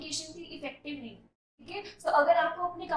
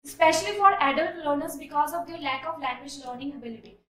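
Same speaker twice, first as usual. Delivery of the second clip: slow, deliberate read-aloud; measured classroom reading; slow essay reading; 7 dB louder, much slower than usual. Shown as a demonstration. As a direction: read the line out clearly. Reads essay sentence.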